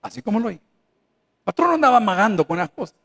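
ordinary talk

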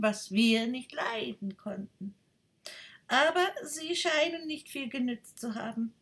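a self-satisfied duck lecturing. Acted.

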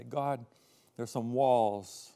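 usual speaking voice

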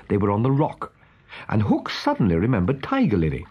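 ordinary delivery

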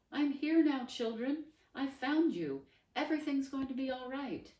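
high, loud, bright reassurance; cheery grown-up rescuer. Acted. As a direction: sweep in and reassure the children.

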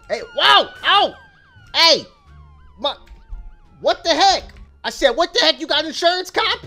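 high, loud indignant shouting; yelling at the cop who hit him; outraged yell. Real, not acted. Car smashed by cop.

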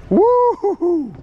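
high drawn-out woo-hoo-hoo whoop, slow and loud; a joyful yell stretched out with a fish on the line; excited whoop. Real, not acted.

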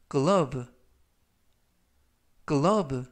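teacher spelling out 글러브 for learners; model pronunciation. Shown as a demonstration. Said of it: "글러브라고 발음하시면 되겠고요".

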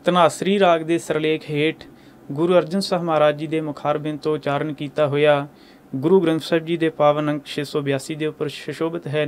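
ordinary talk, nothing marked

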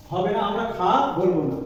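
usual speaking voice

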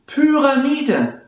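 "Pyramide" with a long Ü and the first syllable pitched high; long Ü model. Shown as a demonstration. Teacher modelling the long Ü in "Pyramide". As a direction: lift and lengthen the y in "Pyramide".

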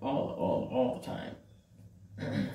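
slow low stretched words; drawn out. Real, not acted. Complaining she came constantly, drawn out slowly. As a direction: drag each word out slowly and low. Dragging out all the time.

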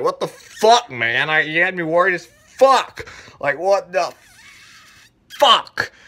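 acted relief turned to outrage; exasperated scolding. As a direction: let him have it, pausing before the last curse. Retold scolding of missing friend.

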